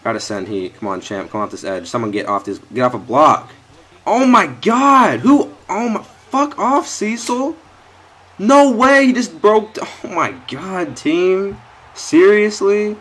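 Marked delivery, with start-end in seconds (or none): 4.1-13.0 very high, loud, drawn-out exclamations; real stunned yelling; shocked outcry. Real, not acted. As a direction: scream in disbelief at the screen. Reacting to teammate's blunder.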